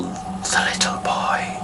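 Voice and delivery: slow, hushed whisper of a film line; whispered quote. Retold or imitated. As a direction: whisper it slowly, imitating the film.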